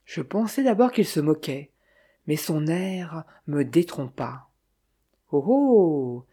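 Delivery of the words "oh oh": sudden surprised exclaim. A narrator's reading. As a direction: caught by something curious, exclaim. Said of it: "s'exclama-t-il tout à coup".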